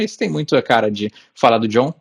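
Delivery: ordinary delivery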